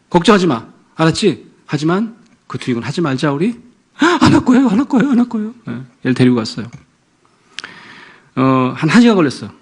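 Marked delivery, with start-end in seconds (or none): 4.0-5.5 put-on much higher, loud child voice; imitating promising daughter. Daughter to father, high eager promise. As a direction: promise eagerly like a little girl.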